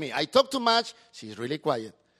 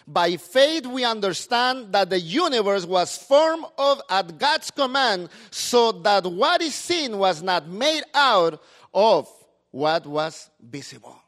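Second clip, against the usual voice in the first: reading the verse out, louder and slower; scripture reading; 7 dB louder, slower than usual. Acted. Pastor reads the verse to the church, loud and measured. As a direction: read the Bible verse out to the church.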